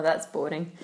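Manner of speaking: normal talking voice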